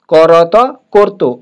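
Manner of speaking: করত then করতো drawn out slowly syllable by syllable; slow Bengali teaching demo; slow sounding out. Shown as a demonstration. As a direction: spell out করতো slowly, each sound apart. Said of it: "এটা হবে না".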